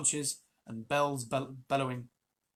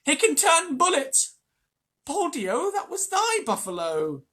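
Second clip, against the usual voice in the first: crowd shouting; 14 semitones higher, 10 dB louder, slower than usual. Acted. Crowd yelling at Mowgli.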